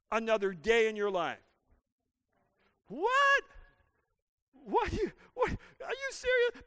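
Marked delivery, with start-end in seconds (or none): 2.9-6.6 mock disbelief.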